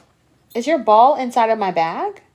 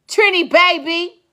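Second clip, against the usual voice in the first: ordinary delivery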